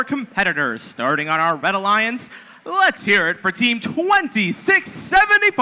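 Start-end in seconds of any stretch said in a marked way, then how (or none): 2.6-5.6 hype intro. Real, not acted. Announcer calls out team intro.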